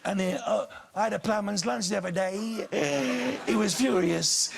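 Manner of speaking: put-on sitcom bungler's voice; Michael Crawford. Shown as a demonstration. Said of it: "he used to talk like this".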